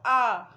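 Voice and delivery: आ called out loud and clear; loud letter sound. Shown as a demonstration. Teacher answering her own question for kids: loud आ. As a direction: give the kids a loud, open आ. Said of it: "अलिफ का sound कैसे निकलता है?".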